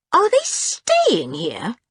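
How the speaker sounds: high, slow, appalled question; horrified. A narrator's reading. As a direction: ask aghast, stretching the words. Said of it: "said George in horror".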